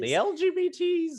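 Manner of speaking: squeaky high boomer impression. Cohost echoing the boomer slip back, high and mocking. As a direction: parrot the boomer phrasing up high. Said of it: "my boomer came out".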